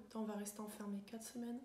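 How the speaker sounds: mope quietly through a list of worries; gloomy grumbling. Acted. Thinking of uncool things in lockdown, gloomy. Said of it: "penser à des trucs pas cool".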